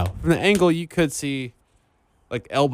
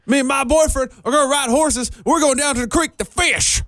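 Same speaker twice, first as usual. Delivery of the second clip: high, hammed-up country accent; country girl; 12 semitones higher, 3 dB louder than usual. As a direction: play a hollering country girl with heavy twang. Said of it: "Oh, country girl".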